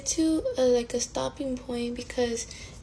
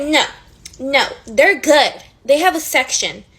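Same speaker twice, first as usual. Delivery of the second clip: mock bouncer; 8 dB louder than usual. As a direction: brush them off like the bouncer. Mocking the bouncer.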